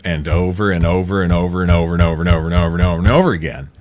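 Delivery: rapid-fire, run-together repetition; rattled off fast. Real, not acted. Host mocking the endless repeating with a fast rattle. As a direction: reel it off at double speed.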